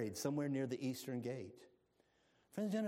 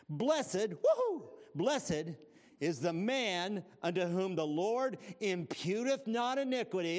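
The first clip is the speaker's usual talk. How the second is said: a high whoop, then a louder read; excited; 4 semitones higher, 5 dB louder, slower than usual. Acted. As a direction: celebrate the word blessed. Excited psalm reading.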